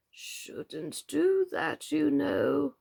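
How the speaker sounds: muttering frog. A narrator's reading. Old frog mutters a warning to Alice, slow and soft. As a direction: grumble it under his breath. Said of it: "the frog muttered".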